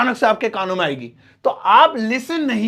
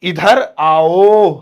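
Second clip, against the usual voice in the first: slow, louder call across the room; drawn-out call; 4 semitones lower, 8 dB louder, much slower than usual. Shown as a demonstration. Teacher demonstrating a slow, loud call across the room. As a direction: stretch it out to call someone over. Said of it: "थोड़ा बढ़ा के भी बोल सकते हैं".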